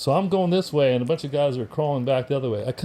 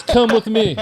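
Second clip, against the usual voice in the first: higher, louder sergeant command through laughter; mock sergeant order; 8 semitones higher, 8 dB louder than usual. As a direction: bark it like a drill sergeant, half laughing.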